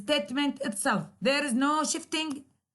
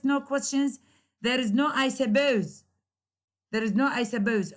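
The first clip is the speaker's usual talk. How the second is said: normal talking voice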